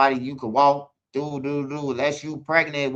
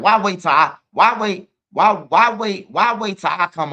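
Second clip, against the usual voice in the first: high and loud, indignant; loud outraged why; worked up; 6 semitones higher, 7 dB louder than usual. Real, not acted. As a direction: push it up, really indignant. Outraged rhetorical question.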